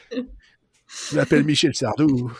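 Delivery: lent et déclamé, un peu fort; imitation de [name]. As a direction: annonce pompeuse façon chanteur. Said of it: "c'est la minute [name]". (voix de [name])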